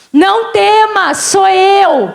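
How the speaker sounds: high, slow, drawn-out proclamation; calling reassurance. Acted. Jesus calls to frightened disciples on the water, calling out. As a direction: call it out with steady authority.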